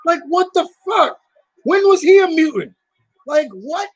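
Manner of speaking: very high-pitched exasperated disbelief; incredulous protest. Real, not acted. Comics fan questioning who counts as a mutant, incredulous. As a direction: voice shoots up, baffled and indignant. Disbelief at a villain being included.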